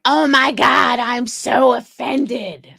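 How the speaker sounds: slow, hammed-up mock outrage, drawn out; mock the offended people with a sneer. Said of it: "these people are like".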